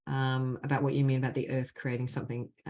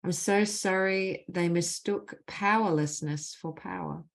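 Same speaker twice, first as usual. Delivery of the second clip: measured, deliberate leading of a phrase; guided healing phrases; 2 semitones higher than usual. Acted. Facilitator gives the group apology phrases to repeat, measured and deliberate. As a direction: lead the group line by line, leaving space after each.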